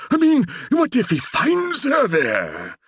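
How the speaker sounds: put-on high, slow anxious old vicar; fretting that his daughter is in danger; fearful Reverend. A narrator's reading. The Reverend fearfully asking Percy about the bedchamber, anxious.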